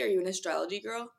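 ordinary talk

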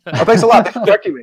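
high, loud mock gratitude; hammed-up resentful thank-you; sarcastic thanks. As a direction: play someone stung by being corrected. Acted sarcastic thanks for a correction.

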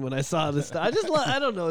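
usual speaking voice

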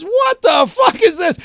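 angry outburst. Retold or imitated. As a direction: hurl it back at the teacher in disbelief. Retold clash with failing teacher.

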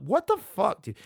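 high, stunned what the fuck; shocked outburst. Real, not acted. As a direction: recoil at the word. Reacting to the slur.

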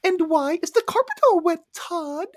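high, breathy put-on woman's voice; Beverly D'Angelo voice. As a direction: play the wife as a Muppet. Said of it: "then I did this impression".

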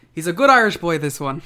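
imitating a wrestler's bad Irish accent, loudly. Podcaster imitating Kyle's Irish accent for his co-host, hammed-up mock Irish. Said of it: "So I could attempt Kyle's attempt at an Irish accent".

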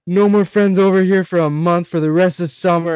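high-pitched imitated scolding; pissed-off mom. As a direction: lay down the law like an angry mother. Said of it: "She just starts getting so pissed".

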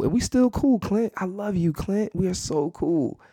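high, sing-out imitation of a woman; imitated placating date. Date assuring him they're still cool, high mock-reassuring voice. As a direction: soften the coming blow in a mock high voice.

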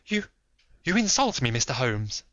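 sharp outraged cry, high and loud; indignant. Acted. Lady to Holmes, indignant outburst. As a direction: spit the insult back. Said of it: "astonishment and indignation".

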